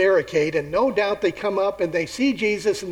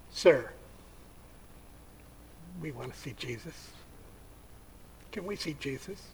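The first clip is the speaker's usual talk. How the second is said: much softer, lower hushed asking; timid request; 3 semitones lower, 14 dB quieter than usual. Acted. Greeks quietly asking Philip to see Jesus, hushed. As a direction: ask meekly, almost under the breath.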